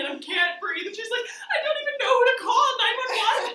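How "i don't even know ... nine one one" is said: high, loud hysterical wail; imitated sobbing panic. Crying sister unsure who to call, loud panicked wail. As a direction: wail helplessly, half laughing. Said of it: "like, sobbing, crying".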